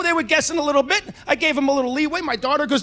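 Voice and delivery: ordinary talk, nothing marked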